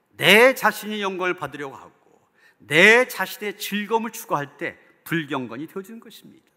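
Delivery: ordinary delivery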